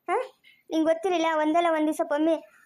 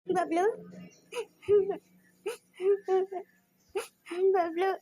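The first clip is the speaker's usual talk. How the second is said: crying; 3 semitones higher, 4 dB quieter, much slower than usual. Real, not acted. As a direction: call the pet name weakly through tears. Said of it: "ಯಾಕಮ್ಮ ಅಳ್ತಾ ಇದ್ಯಾ?".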